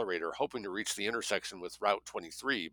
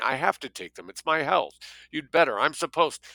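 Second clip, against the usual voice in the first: higher and louder, pleading turning frantic; mounting demand; 6 semitones higher, 6 dB louder than usual. Acted. Greta to Jake, demanding her meds, rising frantic pleas. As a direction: push harder with each line until she snaps.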